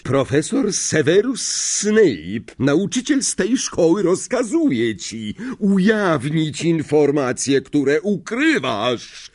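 high, slow, grandiose proclamation; called-out command. A narrator's reading. Professor striking the map with wand, imperious command. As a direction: proclaim it, stretching the key words. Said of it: "zawołał Snape".

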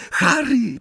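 very high squeal of a name; small high-pitched yelp; squeaked. A narrator's reading. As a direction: squeak it out in alarm. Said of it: "pisnęła Hermiona".

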